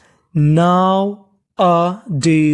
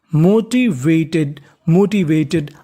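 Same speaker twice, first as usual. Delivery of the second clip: slow model word; 3 semitones lower, much slower than usual. Shown as a demonstration. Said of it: "teacher models the word".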